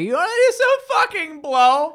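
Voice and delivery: very high, slow, drawn-out squeal; mock squealed offer. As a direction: mimic her with an exaggerated high-pitched invitation. Mimicking a girl offering drugs.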